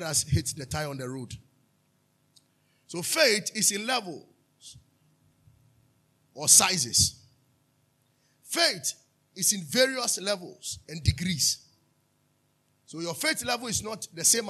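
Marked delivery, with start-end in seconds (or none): 2.9-11.6 slow, loud teaching line; emphatic preaching. Acted. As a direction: lean on every word.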